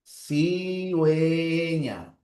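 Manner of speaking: Cigüeña drawn out slowly with the u sounded as w; slow dictation. Shown as a demonstration. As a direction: stretch out Cigüeña syllable by syllable. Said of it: "la transcripción sería así".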